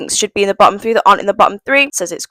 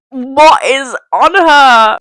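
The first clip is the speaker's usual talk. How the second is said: slow, higher-pitched incredulous question; baffled disbelief; 5 semitones higher, 4 dB louder, much slower than usual. Retold or imitated. Viewer recalling first sight of the neck flower, baffled disbelief. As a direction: draw it out, appalled and baffled. Retold shocked reaction to a look.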